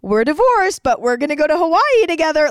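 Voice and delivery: high, loud mocking parent voice; mock them in a high, sing-song voice; mocking divorced parents. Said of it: "It's not like they're like".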